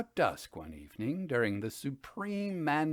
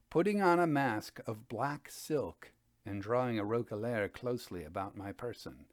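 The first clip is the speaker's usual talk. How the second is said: usual speaking voice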